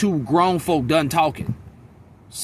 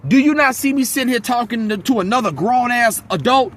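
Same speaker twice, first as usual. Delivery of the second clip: father telling off a pestering son, raised voice; dad scolding; 7 semitones higher than usual. Retold or imitated. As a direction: scold the kid like his father, voice up. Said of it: "My dad like".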